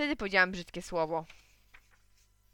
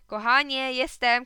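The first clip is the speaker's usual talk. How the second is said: loud, raised homecoming call; shouted; 4 semitones higher, 10 dB louder, slower than usual. A narrator's reading. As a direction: call out to your wife. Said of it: "krzyknął tata". (głośne wołanie od progu)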